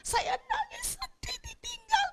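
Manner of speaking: high, slow mocking whimper; hammed-up fake grief; mock crying. Preacher mocking mourners' fake tears, high whimper. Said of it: "Cuma kamuflase".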